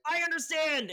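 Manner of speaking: high, loud mock exclamation; mock excited dog. Speaker mocks Hollywood as a dog recognizing another dog, mock excited. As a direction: burst out high and loud, mocking delighted recognition. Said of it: "a dog seeing a dog and being like".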